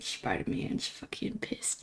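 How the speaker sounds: a low, slow, hoarse-free whisper of a villain mask; Green Goblin whisper. Retold or imitated. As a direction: whisper it like a menacing comic villain. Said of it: "I used to, like, do that voice".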